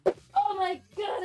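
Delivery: sudden high, loud, drawn-out cry of alarm; startled outburst. Real, not acted. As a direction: blurt it out at full voice, startled. Drops phone while talking.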